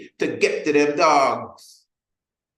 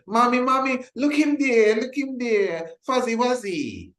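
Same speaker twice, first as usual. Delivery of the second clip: put-on high, pleading little kid voice; be a small child begging mommy in the store; excited child; 12 semitones higher than usual. Child in the supermarket begging mommy for Fuzzy Wuzzy, high kid voice.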